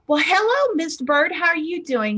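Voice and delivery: high, chirpy hello to a customer; cheery greeting. Retold or imitated. Bank teller greeting a grumpy old regular, cheerily. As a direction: greet him with exaggerated pep.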